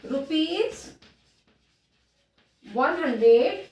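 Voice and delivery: slow dictation with long pauses between each word; a teacher dictating one word at a time. Shown as a demonstration. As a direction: spell out the amount word by word for the class to write. Teacher dictates amount slowly.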